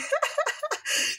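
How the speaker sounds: high, giggly burst of laughter; amused high laughter; real laugh. Real, not acted. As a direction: laugh at your own rambling. Laughs at viewers' imagined reply.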